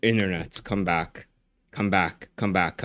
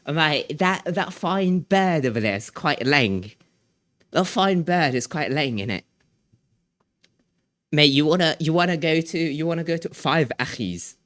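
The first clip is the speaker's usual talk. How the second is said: put-on British slang accent; 2 semitones higher, 4 dB quieter than usual. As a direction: imitate a British lad talking slang. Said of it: "a British term".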